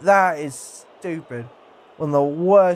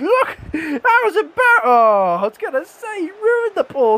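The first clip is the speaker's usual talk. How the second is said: laughing; 11 semitones higher, 4 dB louder than usual. Real, not acted. Laughs at embarrassing tackle.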